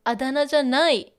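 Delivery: loud, quick あだ名じゃない as a clear gloss; emphatic explanation. Shown as a demonstration. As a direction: push the whole phrase out firmly and fast. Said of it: "It just means".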